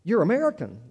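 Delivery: high-pitched imitation of a child; little kids. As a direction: pipe up like a little kid. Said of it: "the little kids were saying".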